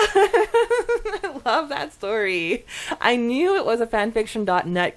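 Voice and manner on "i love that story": slow, high, laughing through it. Real, not acted. Telling the fan how much she loves the story, laughing. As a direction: laugh while you gush. Laughing after fan's memory.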